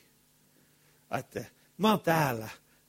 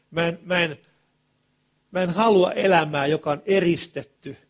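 impassioned cry; 4 semitones higher, 8 dB louder than usual. Real, not acted. Preacher's impassioned plea.

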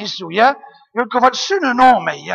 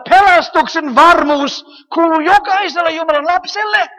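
louder and higher, building preacher climax; preaching at full force; 6 semitones higher, 8 dB louder than usual. Acted. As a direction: proclaim it with full conviction. Rising climax of the sermon. (kiihtyvä, voimakas saarnaus)

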